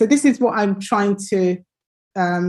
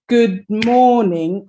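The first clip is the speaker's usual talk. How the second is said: slow "good morning", long stressed "mor"; 4 dB louder, slower than usual. Shown as a demonstration. Teacher clapping out the emphasis, slow "good morning" stressed on MOR. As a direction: draw out and lean on the first syllable of "morning". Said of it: "where I'm putting the emphasis".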